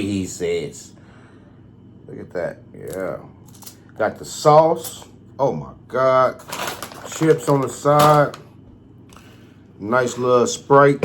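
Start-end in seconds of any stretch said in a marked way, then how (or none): none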